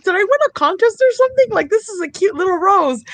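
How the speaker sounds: high, quick wondering; delighted puzzlement. Retold or imitated. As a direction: act out giddy, clueless delight. Acting out naive delight at gift.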